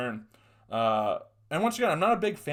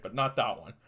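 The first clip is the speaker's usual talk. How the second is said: ordinary talk, nothing marked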